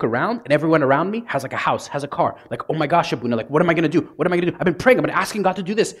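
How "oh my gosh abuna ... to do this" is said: rising, rushed panic; frantic pleading. Retold or imitated. Woman panicking to Abuna about marriage, frantic and rushed. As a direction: spill it out like the clock is ticking. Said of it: "you see the franticness".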